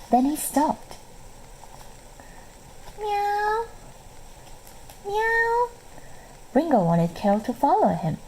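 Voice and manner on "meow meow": high mewing meow meow; cat meowing. A narrator's reading. Ringo calls Carol to come outside with a high meow. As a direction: mew like a small cat calling.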